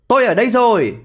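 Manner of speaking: high storybook call. A narrator's reading. Either hedgehog greeting the running hare, calling out.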